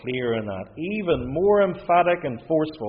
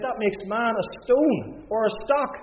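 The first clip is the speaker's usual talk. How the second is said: critics' objection; 7 semitones higher than usual. Retold or imitated. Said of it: "they said".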